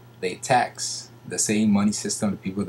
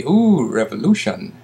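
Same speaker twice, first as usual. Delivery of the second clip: mock excitement; 7 dB louder than usual. Mocking what people say about revolution, mock excitement. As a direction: mimic an excited crowd with a swooping ooh.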